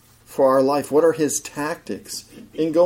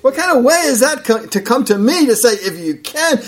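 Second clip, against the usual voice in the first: higher, incredulous mock questioning; sarcastic Jesus; 6 semitones higher than usual. Jesus mocking the father's doubting "if you can", sarcastic. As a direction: throw his doubtful words back at him. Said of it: "Jesus says with this sarcastic voice".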